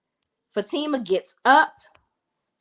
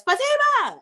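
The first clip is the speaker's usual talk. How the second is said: yelling a name. Retold or imitated. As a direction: shout it up the stairs. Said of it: "and yells up the steps".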